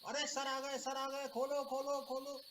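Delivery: panicked call. Retold or imitated. Boys spotting teacher, calling out.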